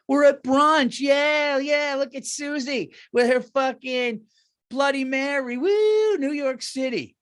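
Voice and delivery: put-on high, loud party-girl voice, shouting; mock the tipsy brunch crowd whooping. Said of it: "people are so dumb".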